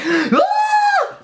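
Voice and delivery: high excited shriek; the Christmas-morning scream of a kid; a sharp squeal of delight; kid's gasp of joy. Retold or imitated. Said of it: "them videos where the kids are like".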